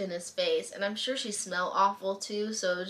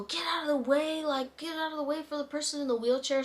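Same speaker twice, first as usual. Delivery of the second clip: imitating a yell; 6 semitones higher than usual. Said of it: "started yelling at people".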